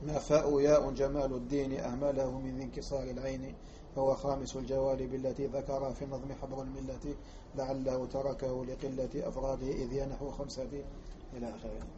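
quiet recitation of a quoted text; reading a quoted text aloud. Acted.

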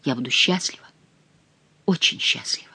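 soft, low and breath-led; tender half-whisper. Acted. Diana confiding her love to her beloved, tender half-whisper. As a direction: let the happiness sink into a whisper.

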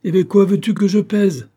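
loud, high, incredulous comeback; impatient shouted question; exasperated retort. Acted. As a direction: throw it back forcefully, pitch way up. Benzouf retorts to the plaintive question.

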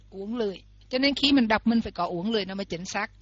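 normal talking voice

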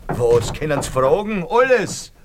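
rising to a high, loud cry of alarm; panicked outburst. Acted. Landlord fearing he loses everything, panicked high cry. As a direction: panic climbing to a shriek on the last word.

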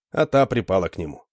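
usual speaking voice

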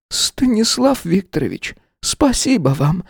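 high, slow, quavering thanks; grateful, close to tears; trembling voice. A narrator's reading. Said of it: "дрогнувшим голосом сказала". (дрогнувшим голосом)

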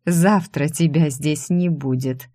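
plain reading voice

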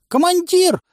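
very high, loud panicked shriek; hysterical squeal. A narrator's reading. Man lifted off the ground by his collar, hysterical squeal. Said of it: "истерично взвизгнул Клоп".

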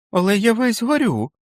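put-on the boy insisting he is burning up; feverish child protesting. A narrator's reading. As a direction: protest like a sick little kid. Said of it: "заперечив Річард".

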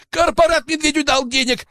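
very high, strained squeal; panicked wail. A narrator's reading. Poacher confesses at knifepoint, high panicked wail. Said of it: "завопил браконьер".

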